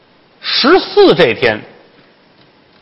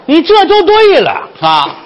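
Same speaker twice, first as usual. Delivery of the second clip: high, drawn-out cry of approval; gushing, theatrical approval; delighted approval; 4 dB louder, slower than usual. Acted. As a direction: stretch it out high, thrilled with her. Madam delighted by her change.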